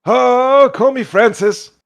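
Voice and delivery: put-on high, loud, folksy old-timer voice; squeaky cheerful old boggart greeting travellers. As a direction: play the friendly old fisherman by the fire. GM answers as the boggart.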